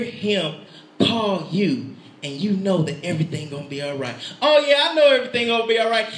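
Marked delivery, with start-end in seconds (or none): 4.4-6.1 fast, high mock agreement; glib assurance. Believer saying it in the head, not heart, glib quick assurance. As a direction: rattle it off as head-knowledge only. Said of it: "you say that in your head".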